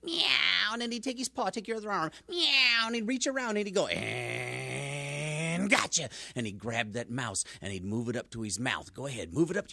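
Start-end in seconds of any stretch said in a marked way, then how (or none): 0.0-0.7 cat meow. Acted. Storyteller voicing cat meow. 2.3-2.9 cat meow. Acted. Storyteller voicing cat meow. 3.9-6.1 slow drawn-out gotcha. Acted. Cat pouncing on mouse.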